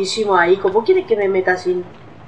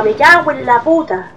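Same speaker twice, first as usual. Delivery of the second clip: normal talking voice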